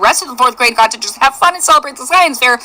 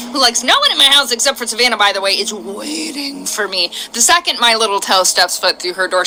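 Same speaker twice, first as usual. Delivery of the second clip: ordinary talk, nothing marked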